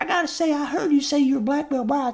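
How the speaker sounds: very high southern girl imitation; mock angry teen girl. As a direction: complain like a let-down fourteen-year-old. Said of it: "she was like".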